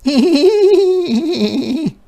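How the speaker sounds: a mock giggle, high-pitched and loud; a giggle forced out on cue; giggle demo. Shown as a demonstration. Teacher demonstrating a giggle for children. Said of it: "to laugh quietly like, like this".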